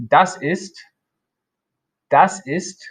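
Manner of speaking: careful German example; German model phrase. Shown as a demonstration. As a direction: model das ist clearly for learners. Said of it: "And then we have the response".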